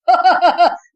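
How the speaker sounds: high, short jeering laugh; mocking laugh. A narrator's reading. Rohan laughing mockingly at the two boys. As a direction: laugh at them.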